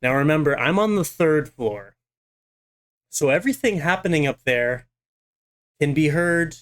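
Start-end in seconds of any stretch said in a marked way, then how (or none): none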